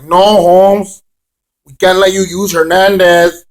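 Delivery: lightly hammed-up Chicano slang voice. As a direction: brush them off like a homeboy. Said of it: "Lucha Underground was like".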